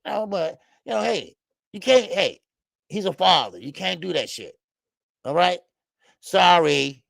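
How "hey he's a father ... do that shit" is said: ordinary talk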